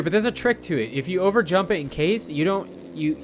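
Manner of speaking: normal talking voice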